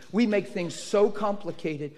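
usual speaking voice

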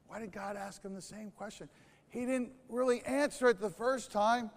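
ordinary talk, nothing marked

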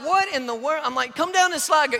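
high, loud exasperated call; exasperated dad. Retold or imitated. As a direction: urge her down impatiently.

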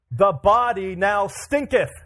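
high, loud, slow King James oratory; grand rhetorical flourish. Acted. Reading the King James verse to church, grand rhetorical flourish. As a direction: declaim it grandly, drawing out each word. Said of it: "it is like beautiful rhetorical flourish".

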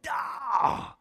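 high, drawn-out "aah" of pain; mock pain yelp. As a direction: imitate jumping at a poked sore spot. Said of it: "you come up off the table".

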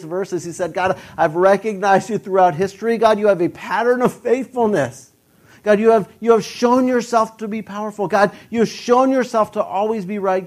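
loud, high, driving sermon voice; a pastor building to his climax; full-force preaching. Acted. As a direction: hammer the point home to the congregation.

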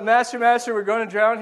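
desperate disciples crying out for help; panicked cry. Retold or imitated. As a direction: shout it like they're sinking. Disciples panicking, waking Jesus.

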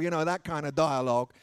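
ordinary talk, nothing marked

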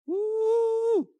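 high, excited woo; excited whoop. Real, not acted. Speaker cheering the learning zone with an excited high whoop. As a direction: whoop with real delight.

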